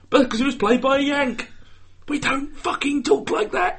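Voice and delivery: high-pitched indignant protest; mock indignation. Real, not acted. Brit objecting to bad accents, high and indignant. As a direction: squeal it out, dragging the last words. Mocking Americans' fake English accent.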